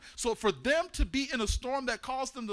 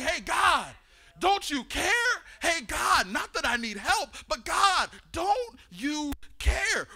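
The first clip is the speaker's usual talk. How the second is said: higher, louder nagging at God; whiny complaint; 6 semitones higher, 4 dB louder, slower than usual. Acted. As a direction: grumble at God like he forgot you. Congregant complaining to God, acted.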